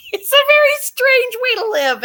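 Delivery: laughing it out high and loud; a big real laugh into the words; giggling through the line. Real, not acted. Laughing about being feared.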